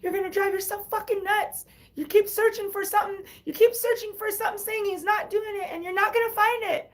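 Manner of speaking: high mock-nagging friend voice; imitating a friend. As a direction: play the fed-up best friend telling her to stop. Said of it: "but she's like".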